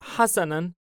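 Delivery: put-on high little-boy voice with a smile; smiling child. Child agrees to stay with Hayim, smiling child voice. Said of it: "الطفل باسما".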